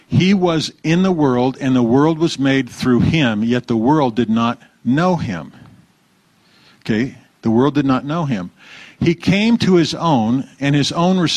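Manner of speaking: solemn scripture reading. Acted.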